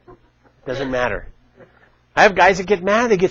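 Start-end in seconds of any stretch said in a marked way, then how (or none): 0.1-1.3 softer and slow, letting matter settle; quiet repeat. Shown as a demonstration. Coaching listeners not to argue, quiet slow repeat. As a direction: drop it down and slow it right off. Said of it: "repeats phrase for emphasis".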